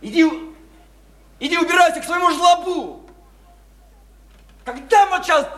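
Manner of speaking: high, loud shouting; furious yell. Acted. Drunk Lyosha yelling at the woman to leave. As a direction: drive her out at full voice.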